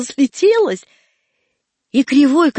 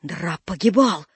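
usual speaking voice

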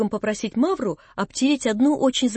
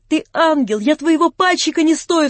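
higher and louder, bursting with delight and remorse; rapturous, remorseful cry; 6 semitones higher, 7 dB louder than usual. A narrator's reading. Alyosha exclaims to Natasha, rapturous and remorseful. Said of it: "вскричал Алёша с восторгом и с раскаянием".

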